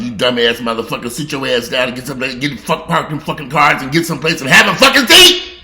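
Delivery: loud angry cussing rant. Real, not acted. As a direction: let them have it at full volume. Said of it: "I'll cuss them motherfuckers".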